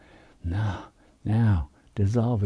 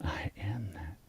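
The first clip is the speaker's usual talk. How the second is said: much softer, unvoiced whisper; whispered repeat; 2 semitones lower, 15 dB quieter than usual. Shown as a demonstration. Teacher models the practice by whispering the affirmation. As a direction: let the words fade into a whisper. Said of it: "by having it even practice".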